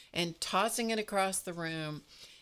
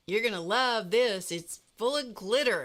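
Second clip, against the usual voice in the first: higher, louder imitation of his excitement; gleeful announcement; 5 semitones higher, 7 dB louder than usual. Retelling the friend showing off the bag, loud gleeful imitation. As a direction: sing out the big reveal, teasing. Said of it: "And he goes".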